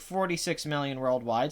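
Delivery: ordinary talk, nothing marked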